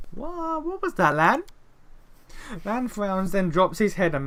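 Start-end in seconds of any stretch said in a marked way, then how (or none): 0.1-1.4 much higher, startled question; startled companion. Acted. Companion questioning Lan, startled high voice. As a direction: ask him, caught off guard.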